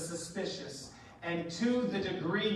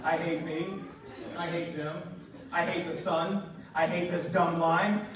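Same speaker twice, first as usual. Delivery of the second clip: put-on hateful kid's look; 5 semitones lower than usual. Kids glaring at parents in line, mock low loathing. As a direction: voice a child's silent, seething resentment. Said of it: "the look that says all the things".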